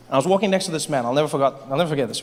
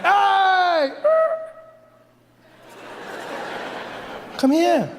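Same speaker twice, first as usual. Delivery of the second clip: pleading New Yorker; 4 semitones higher than usual. Retold or imitated. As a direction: beg the too-far car to come closer. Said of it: "It's just like".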